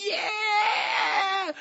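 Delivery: very high, long, drawn-out shriek; hammed-up shriek of agreement; imitated thrilled squeal. Friend cheering the vision, thrilled squeal.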